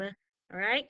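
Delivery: usual speaking voice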